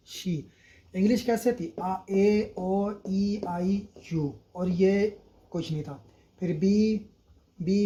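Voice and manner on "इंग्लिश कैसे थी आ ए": ordinary delivery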